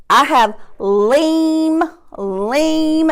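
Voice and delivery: slow, clear lean; slow word ending. Shown as a demonstration. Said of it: "I'm going to give you the ending".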